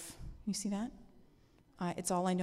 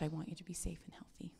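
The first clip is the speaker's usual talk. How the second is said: hushed quick whisper, tender; 3 semitones lower, 10 dB quieter, faster than usual. Acted. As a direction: drop to a soft secret whisper. Tender point of the sermon.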